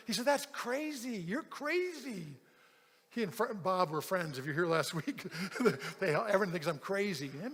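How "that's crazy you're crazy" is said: high, slow, drawn-out disbelief in an imitated voice; mock exasperation, softer and stretched out; mad caller. Upset churchgoer scolding his pastor, high and incredulous. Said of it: "he's all mad at me".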